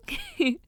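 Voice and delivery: short, high-pitched giggle. Acted. Bantering with book boy, a high giggle. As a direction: squeak out a playful giggle. Laughs after "No, you.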